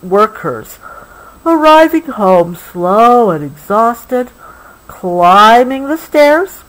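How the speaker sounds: gentle, unhurried storybook read; reading to a child. Acted. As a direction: soothe a child toward sleep with the story. Reading the book slowly.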